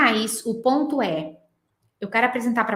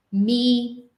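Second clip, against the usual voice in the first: normal talking voice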